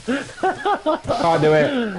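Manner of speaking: high, very slow, stretched-out refusal; high stretched-out can't do it; drawn-out refusal. Acted. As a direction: drag it out in disbelief. Refusing the Hitler choice.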